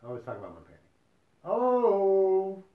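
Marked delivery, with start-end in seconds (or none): 1.4-2.6 long, high, loud "Oh!"; drawn-out joking outcry; mock loud "oh". Mock outburst after painting remark.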